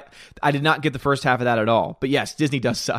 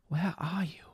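whispered search for the chat; soft whispered where are you; playful whisper; 4 semitones higher, 10 dB quieter, slower than usual. Pretend. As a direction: hush it like hide and seek. Searching for lost chat message.

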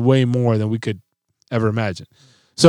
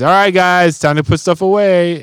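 slightly raised, louder dad call; calling the kids; 6 semitones higher, 4 dB louder than usual. Retold or imitated. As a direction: mildly voice a parent rounding up children. Retelling calling the kids to help.

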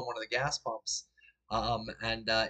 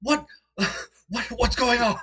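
high, loud, bewildered panic; freaking out; 11 semitones higher, 7 dB louder than usual. Acted. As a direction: stammer out alarmed questions, rising in volume. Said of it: "he's freaking out".

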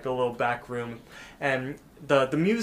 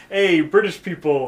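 loud, higher retold greeting; calling out; 4 semitones higher, 8 dB louder than usual. Drunk friend hailing British strangers, calling out. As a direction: hail the group noisily.

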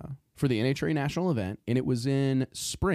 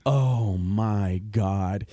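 mortified; 3 dB louder, much slower than usual. Retold or imitated. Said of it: "see, I am mortified".

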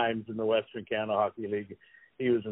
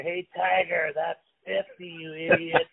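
a little higher, mock-gloating jab at a rival; gloating taunt; 5 semitones higher, slower than usual. Skating past the bench, needling Tiger after the fiftieth goal, gloating. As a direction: rub it in as you skate past the bench. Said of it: "I'm going like this".

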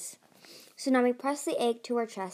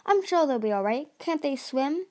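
dismissive; 6 semitones higher, 4 dB louder than usual. A narrator's reading. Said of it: "waved her claws dismissively".

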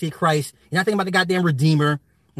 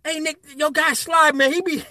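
higher, laughing mock suspicion; imitating Nick's man; 9 semitones higher than usual. Quoting Nick's man doubting Sly, laughing. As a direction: skeptical friend, giggling. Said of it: "Nick's man's gonna be like".

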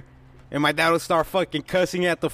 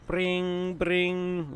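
sing-song phone ring, higher; phone ring imitation; 5 semitones higher, 4 dB quieter than usual. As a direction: mimic a ringing phone. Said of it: "just pretend I'm calling you".

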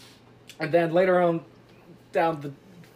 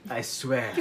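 put-on clumsy French detective accent; Poirot; 2 semitones lower, 3 dB quieter than usual. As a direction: accuse in a shaky French accent. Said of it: "Poirot comes in the room, he's like".

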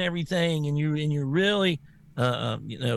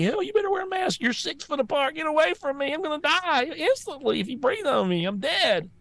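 much higher, hysterical mock of a covid worrier; panicky mock voice; 12 semitones higher than usual. Host mocking scared maskers, high hysterical. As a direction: shriek it like a terrified germaphobe. Mocks panicked mask people.

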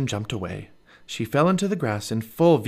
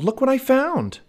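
excited raised-voice call, high; 5 dB louder than usual. A narrator's reading. Jack shows off what he found, calling high and loud. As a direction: call out your discovery. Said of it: "Jack called".

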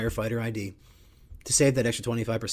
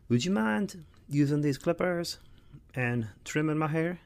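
sheepish request; 6 semitones higher than usual. Retold or imitated. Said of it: "Hey, babe".

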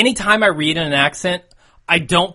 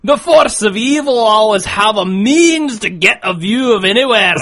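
loud, higher-pitched broad Scots; Scottish accent; 4 semitones higher, 5 dB louder than usual. Pretend. Podcaster reading listener mail on request, broad Scottish accent. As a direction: declaim it in a rolling Scottish burr. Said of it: "do a Scottish accent, but I'll try".

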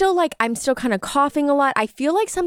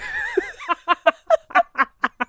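high laugh. Real, not acted. Laughing at own story.

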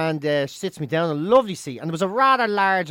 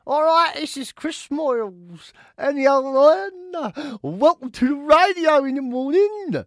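high, loud, drawn-out radio DJ impression; Chris Moyles; 9 semitones higher, 6 dB louder, much slower than usual. Shown as a demonstration. Comedian mimics English radio host on request, hammed-up. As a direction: stretch each phrase like a hyped-up DJ. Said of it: "your impression of Chris Moyles".

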